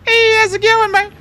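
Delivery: very high, strained squeak from tight pants; high squeaky voice. Pretend. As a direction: squeeze the voice up into a tiny squeak. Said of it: "do you talk like this?".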